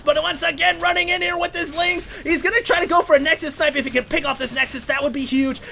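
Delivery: hyped caster calling the base kill; excited. Real, not acted. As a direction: ride the tension of the snipe. Excited call of Nexus snipe.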